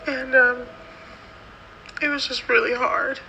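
telling it while close to crying; near tears. Real, not acted. Recalling the bathroom with mom, near tears.

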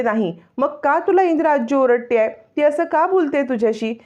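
put-on a child who won't let it go; child's voice. Child Sai questions Mukta. (लहान मुलीचा उंच, नाराज प्रश्न)

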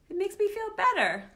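bright happy repeat. Shown as a demonstration. Reading the happy page to children, joyfully. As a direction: lift it up with joy. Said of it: "And it makes me feel better".

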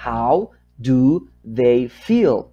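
"how do they feel" very slow, each word on its own; slow dictation. Shown as a demonstration. Teacher writing number three for learners, slow word-by-word dictation. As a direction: drag out each word with gaps between.

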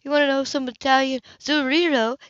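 sarcastic echo of his phone talk; mocking Hannah. Hannah mimics then warns.

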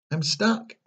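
high-pitched cry of dismay; trapped boy's high wail. A narrator's reading. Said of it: "cried Pinocchio".

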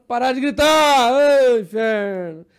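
very loud, drawn-out yell; imitated angry passenger. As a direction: shout it in exasperation for everyone to hear. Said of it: "Tentando chamar a atenção de outros em volta".

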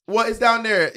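high mock Jamaican accent; imitation Jamaican-accented question; [name]. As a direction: lift it into a teasing Caribbean lilt. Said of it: "busting out with a Jamaican".

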